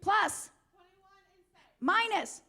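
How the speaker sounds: loud, high call to the crowd; rally the room with it; shouting call-out. Real, not acted.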